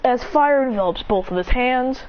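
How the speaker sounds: ordinary delivery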